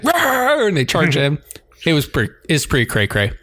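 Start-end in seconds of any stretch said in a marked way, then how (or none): 0.0-0.7 mock battle roar. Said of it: "And everybody's just like".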